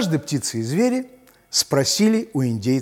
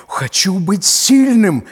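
jaguar voice; 5 semitones higher, 6 dB louder than usual. Acted. Jaguar asks to be strong.

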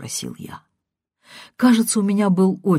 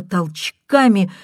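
ordinary delivery

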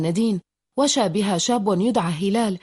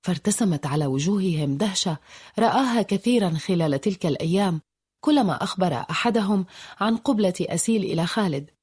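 ordinary delivery, nothing marked

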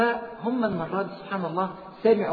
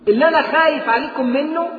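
higher and louder, crying out the warning; full-force preaching; 9 semitones higher, 8 dB louder than usual. Acted. Preacher warns listeners about worldly wealth, loud high preaching. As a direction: lift the voice to a peak.

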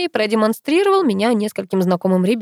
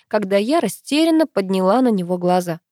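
plain reading voice

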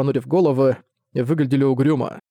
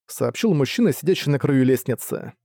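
usual speaking voice

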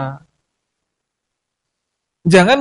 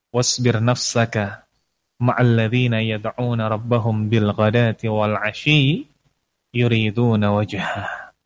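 Quran recitation; 7 semitones lower, 4 dB quieter than usual. Acted. Preacher recites the verse to the congregation.

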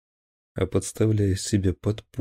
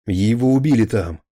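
angry shout; 3 semitones higher, 6 dB louder than usual. Acted. Captain shouting at officers.

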